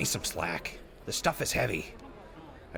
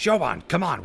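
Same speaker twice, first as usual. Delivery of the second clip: raised voice reining in a friend; louder urgent call to calm her; loud plea; 6 semitones higher, 7 dB louder than usual. Acted. Calling out to stop Shoban's outburst, loud and urgent.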